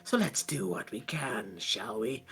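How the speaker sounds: slow, low, whispery ancient creature; huge monster voice. Acted. Aboleth speaks to the captured little cat, huge whispery voice. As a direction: breathe it out softly and deep, with long menacing pauses. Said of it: "you can hear this huge voice".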